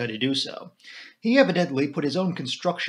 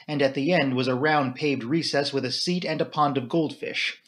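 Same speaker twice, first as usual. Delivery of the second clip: ordinary delivery, nothing marked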